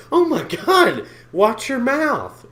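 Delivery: high, loud, shocked gasp; mock pearl-clutching. Mocking people who hate cursing, scandalized shriek.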